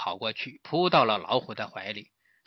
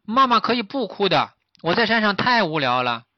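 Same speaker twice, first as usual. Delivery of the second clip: lightly voiced child, a little higher; complaining fawn; 7 semitones higher, 4 dB louder than usual. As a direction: argue back to the master as a young fawn. (小鹿童声抱怨)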